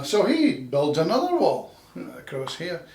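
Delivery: normal talking voice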